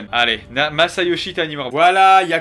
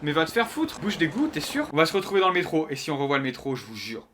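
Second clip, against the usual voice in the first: ordinary talk, nothing marked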